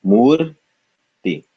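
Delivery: "Mur" high and rising, pause, then "ti"; syllable by syllable. Shown as a demonstration. Teacher breaking up the word, "Mur, ti" syllable by syllable. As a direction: sound out "Mur" and "ti" separately. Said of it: "when we break this up".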